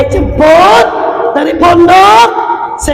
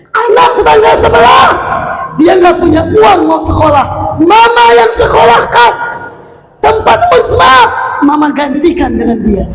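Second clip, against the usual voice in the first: pointed threat; 7 semitones higher than usual. Retold or imitated. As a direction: tease the child with a rival. Mother threatening to replace child.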